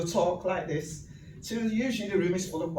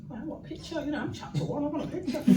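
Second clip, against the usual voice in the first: self-talk quote; 10 semitones higher, 3 dB quieter, faster than usual. Retold or imitated. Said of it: "I said to myself".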